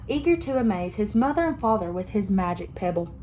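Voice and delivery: usual speaking voice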